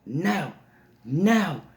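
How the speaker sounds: put-on high-pitched little-kid refusal; defiant toddler. Toddler refusing the babysitter at bedtime, high and defiant. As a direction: imitate a stubborn small child.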